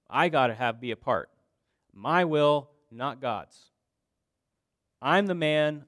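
mock indignant outburst. Preacher voicing disciples who reject the teaching, loud indignation. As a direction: declare it like a self-willed objector. Acts disciples refusing God's sovereignty.

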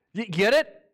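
ordinary talk

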